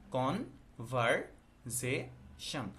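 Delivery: Conversation stretched very slowly, split into its separate syllables. Shown as a demonstration. Teacher breaking a word into syllables for students, very slow. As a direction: spell out conversation slowly, one syllable at a time, kɔn-var-dʑe-ʃan. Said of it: "এটাকে আমরা ভাঙতে পারতেছি কিভাবে?".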